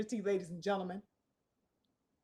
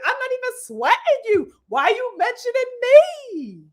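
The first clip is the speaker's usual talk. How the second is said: high, whiny mock diva voice, higher pitched; mocking sassy fighter; 6 semitones higher than usual. Vlogger mocking boxers by imitating their whiny sassy talk. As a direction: sneer at them by playing a prissy fighter.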